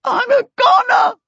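shrill panicked wail at full volume; blubbering. A narrator's reading. Rollo wails that he's doomed, loud high blubbering. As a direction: howl it out tearfully, very high and loud. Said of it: "he blubbered".

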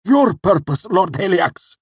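very high, loud, strangled answer; choked out. A narrator's reading. Cinder swearing loyalty to Haliax, choked and pained. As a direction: choke it out in agony. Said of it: "The words were choked out".

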